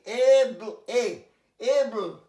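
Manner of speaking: এবল slow and loud; pronunciation drill. Shown as a demonstration. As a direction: stretch out এবল syllable by syllable. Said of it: "pronunciation drill". (ধীরে ধীরে এবল উচ্চারণ)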